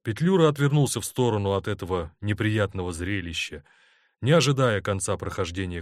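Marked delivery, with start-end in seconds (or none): none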